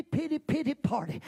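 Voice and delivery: ordinary talk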